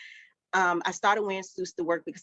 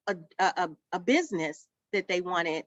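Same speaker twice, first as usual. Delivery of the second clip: usual speaking voice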